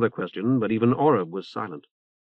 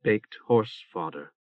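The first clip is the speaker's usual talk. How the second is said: sardonic; 3 semitones lower, 4 dB quieter, slower than usual. Acted. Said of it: "Hyacinth told her".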